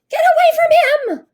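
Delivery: very high, loud animal scream; bear screaming. A narrator's reading. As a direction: scream like a furious mother bear. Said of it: "Mala screamed".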